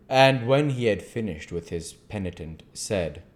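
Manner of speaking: plain reading voice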